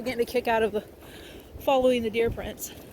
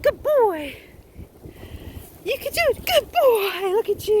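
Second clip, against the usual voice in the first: very high, loud praise for the dog; excited dog praise; 10 semitones higher, 6 dB louder than usual. Real, not acted. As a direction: gush over him as he clears it. Praising dog over obstacle.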